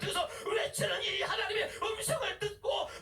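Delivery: full-force preaching, pitched high and sustained. Acted. Preacher summoning God's servants to speak up, fervent preaching. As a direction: call out to the congregation like a herald.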